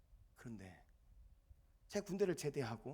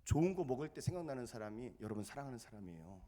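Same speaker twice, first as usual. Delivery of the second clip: normal talking voice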